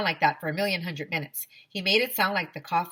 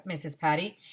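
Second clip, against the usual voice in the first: ordinary delivery, nothing marked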